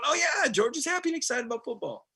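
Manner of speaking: lightly hammed-up excitement; mock fan glee. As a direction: gush like a happy fan. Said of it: "you're going".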